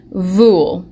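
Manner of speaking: "Vule" blended as one syllable, vool; sounding out a word. Shown as a demonstration. Teacher sounding out a made-up word for the class. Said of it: "that's not another word".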